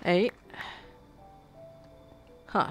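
ordinary talk

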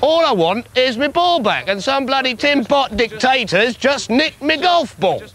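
put-on stamp your foot like a spoilt child; whiny tantrum. Petulant demand for ball.

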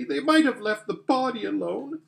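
put-on high-pitched, distressed young girl's plaint; acted sobbing. Lucy upset the body is gone, mild acted sob. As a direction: lament it, lightly tearful. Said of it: "sobbed Lucy".

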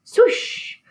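breathy swoosh; whooshing pounce sound. A narrator's reading. Narrator voicing the pup's pounce, swoosh. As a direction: sweep the air like a pounce. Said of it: "She pounces".

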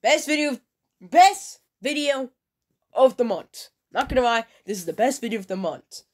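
high, loud emphatic praise; excited declaration. Real, not acted. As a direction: proclaim it loudly. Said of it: "I don't wanna hear it".

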